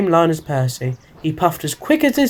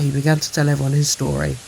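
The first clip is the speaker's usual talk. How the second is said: ordinary delivery, nothing marked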